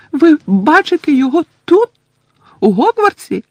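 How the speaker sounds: high squeaky elf voice, amazed; astonished house-elf. Acted. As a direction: ask in wide-eyed wonder. Winky asks. (здивована ельфійка)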